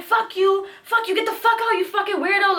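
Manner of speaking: high yelling at him; going off. Retold or imitated. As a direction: curse him out. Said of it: "going off on him".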